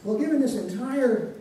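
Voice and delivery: ordinary talk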